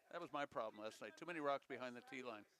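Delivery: ordinary talk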